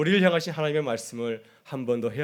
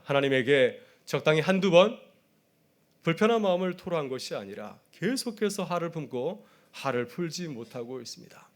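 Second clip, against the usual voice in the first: ordinary talk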